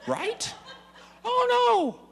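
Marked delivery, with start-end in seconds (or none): 1.2-2.1 high, loud comic horror; mock dismay. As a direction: shriek of comic disappointment. Realizing mob targets his side.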